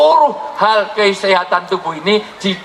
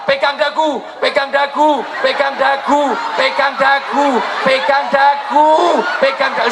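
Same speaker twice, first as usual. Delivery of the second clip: shouted command; 6 semitones higher, slower than usual. Real, not acted. Preacher drills congregation in movement.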